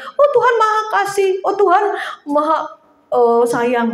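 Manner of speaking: mocking a complacent believer; mock pious gush.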